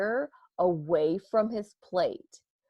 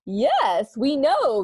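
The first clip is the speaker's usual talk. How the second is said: shouted back; 6 semitones higher, 5 dB louder, much slower than usual. Acted. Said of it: "the donuts shouted back".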